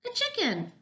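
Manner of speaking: very high astonished cry; high-pitched storybook shout; shouted exclamation. A narrator's reading. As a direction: shout it as a whole group would. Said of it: "they all shouted".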